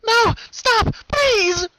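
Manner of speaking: high, loud, terrified screaming pleas; hysterical cries. Acted. The strapped-down sister begging the colt, screaming. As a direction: scream for your life. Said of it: "hysterical cries from her sister".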